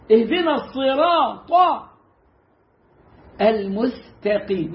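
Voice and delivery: الصراط with a heavy ص and المستقيم with a light س, slowly; correct recitation. Shown as a demonstration. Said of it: "في الفاتحة لا". (تلاوة مضبوطة ببطء)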